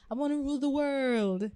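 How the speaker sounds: lightly voiced movie villain; a hint of supervillain menace; Bond villain voice. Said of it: "like a Bond villain and say".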